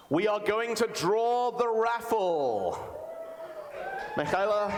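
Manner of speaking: rousing stage announcer call; raffle announcement. Real, not acted. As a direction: announce it big, stretching the last word.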